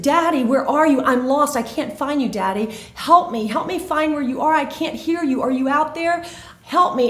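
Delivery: put-on high, urgent pleas of a lost girl; scared child crying out. Lost girl begs daddy for help, scared and urgent. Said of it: "she's crying and she's dirty and she's scared".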